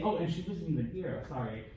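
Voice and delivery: ordinary talk, nothing marked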